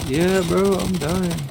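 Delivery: high, strained panic; yelping under fire; panicking gamer. Real, not acted. Low health in a firefight.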